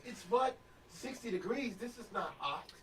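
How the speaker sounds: high-pitched incredulous mimicry; mock disbelief. Teasing Louisiana transplants about New Jersey weather, mock disbelief. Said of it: "They're gonna be like".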